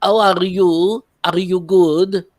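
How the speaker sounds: put-on slow, playful French accent greeting; mock French accent. Host hamming up a French accent for a viewer. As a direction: stretch it out like a bad French tourist. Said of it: "I'm speaking with my best French accent".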